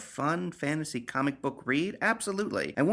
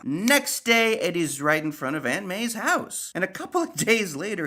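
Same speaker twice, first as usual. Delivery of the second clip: normal talking voice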